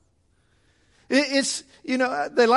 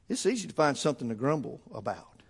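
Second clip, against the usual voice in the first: normal talking voice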